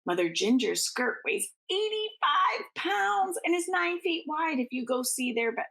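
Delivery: ordinary delivery, nothing marked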